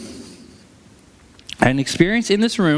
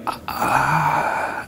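a helpless voiceless ah; stuck for words beside a crying woman, a breathed ah; speechless breath; 3 semitones lower, 3 dB quieter than usual. Retold or imitated. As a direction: let out a lost, breathy sigh. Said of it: "I just was standing there like".

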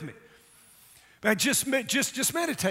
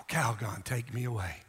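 much lower and softer, dreamy mock ad line; mock ad sigh; 10 semitones lower, 8 dB quieter than usual. Pastor joking after the shout, soft mock commercial. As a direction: sigh it like a bath ad. Ad slogan joke.

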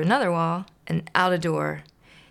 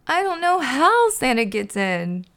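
higher and louder, upset; about to cry, desperate; hammed-up distressed bird; tearful Big Bird; 7 semitones higher, 6 dB louder than usual. Big Bird near tears.

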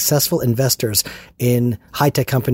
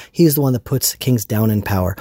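normal talking voice

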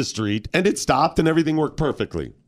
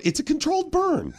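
very high put-on manager voice; dismissive manager; 13 semitones higher, 4 dB quieter than usual. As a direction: brush it off in a comic high pitch. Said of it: "If I was the manager".

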